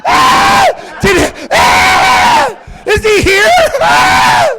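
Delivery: high, loud shrieking passenger; mock panic. Frantic passenger shrieking at a scorpion on the plane. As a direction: scream as if the scorpion is near.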